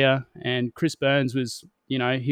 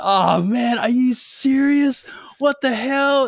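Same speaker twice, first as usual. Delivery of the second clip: high, slow, aghast cry; dismayed disbelief; 10 semitones higher, 4 dB louder, slower than usual. Retold or imitated. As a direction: play the horror of losing. Realizing he lost race.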